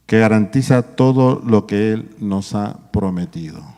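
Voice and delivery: loud, lifted pulpit reading of the verse; scripture reading. Acted. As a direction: proclaim the Bible text to the congregation. Reads Corinthians verse aloud.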